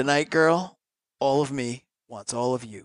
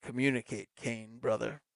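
lower, softer crooning soul voice; 4 semitones lower, 13 dB quieter than usual. Retold or imitated. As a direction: croon it low and intimate like a soul singer.

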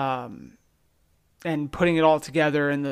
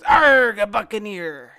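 loud higher Arg, punchline dropping off; pirate voice; 4 semitones higher, 6 dB louder, slower than usual. Retold or imitated. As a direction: ham up a cartoon pirate. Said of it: "And the other pirate goes".

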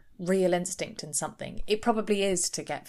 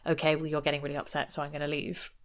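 cold dismissal; 4 semitones lower, 8 dB quieter, faster than usual. Retold or imitated. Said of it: "someone going".

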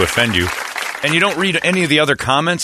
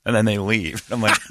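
ordinary talk, nothing marked

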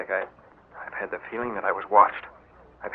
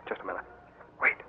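much softer, tense hush; hushed alarm; 2 semitones lower, 5 dB quieter, faster than usual. Acted. Harvey to Blackjack in the dark, hushed alarm. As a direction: stop him under your breath. Sneaking in darkness, senses follower.

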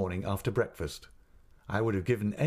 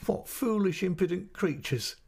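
high, teasing laughing exclamation; laughing cry; 10 semitones higher than usual. Acted. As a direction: toss it off with a delighted laugh. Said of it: "cried my mother, laughing".

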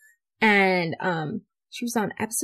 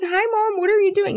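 high-pitched, louder mock-innocent hello; retold awkward greeting; 13 semitones higher, 5 dB louder than usual. Daughter walking in on mom's steamy show, awkward. Greeting mom caught watching sex scenes.

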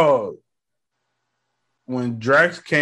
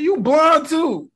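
mock retort; 13 semitones higher, 3 dB louder, slower than usual. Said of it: "I was like".

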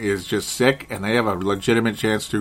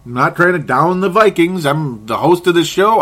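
ordinary talk, nothing marked

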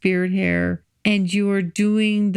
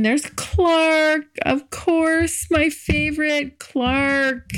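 higher, slow and fond, Clark stretched; delighted; 6 semitones higher, much slower than usual. Real, not acted. Introducing her favorite date to listeners, delighted and high. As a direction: announce your favorite with glee.

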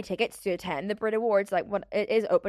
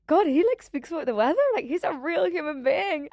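high-pitched, hammed-up wonder; acting out awestruck fangirl astonishment; starstruck amazement; 7 semitones higher, 3 dB louder than usual. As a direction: marvel in mock disbelief, pitch raised. Acts out starstruck amazement at actor.

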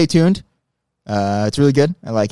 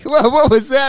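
mocking quote; 12 semitones higher, 4 dB louder than usual. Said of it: "you guys were like".

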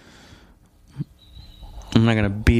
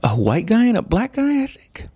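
clueless answer. Retold or imitated. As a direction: play dumb, pitch way up. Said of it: "And I'll be like".

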